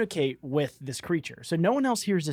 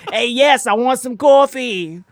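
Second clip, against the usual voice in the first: loud, higher Brooklyn goblin reply; Brooklyn accent; 6 semitones higher, 9 dB louder, slower than usual. Shown as a demonstration. Goblin eagerly takes the coffee, loud Brooklyn accent. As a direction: boom it back in Brooklynese. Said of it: "they all talk like this".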